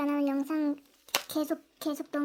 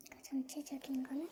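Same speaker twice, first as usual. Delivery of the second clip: whispering; 9 dB quieter than usual. Real, not acted. Whispering beside the filming child.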